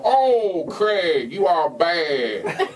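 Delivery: drawn-out mock scolding, slow on the end; imitating a slow, chiding co-host; co-host's catchphrase. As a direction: drag out "you are bad". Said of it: "He'd go".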